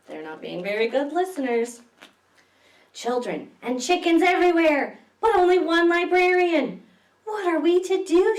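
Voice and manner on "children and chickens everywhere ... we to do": higher, louder despairing cry; exasperated librarian. Acted. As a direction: throw up your hands and cry out. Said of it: "she says".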